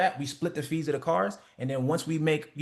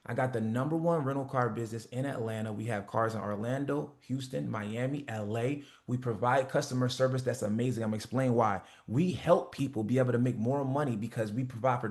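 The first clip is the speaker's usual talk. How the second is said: confident sales pitch; 3 semitones lower than usual. Shown as a demonstration. Coach demoing a rental car pitch, calm confident sales voice. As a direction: sell it calmly like you own the market. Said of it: "say the price the same way you describe the product".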